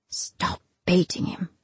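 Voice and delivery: soft, breathy, urgent thought; desperate inner thought. A narrator's reading. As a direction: plead silently, almost whispered. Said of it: "Evan thought desperately".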